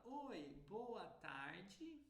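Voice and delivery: slow, high, open Brazilian greeting; sing-song Brazilian accent, tarde ending djee. Shown as a demonstration. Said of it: "Um brasileiro fala".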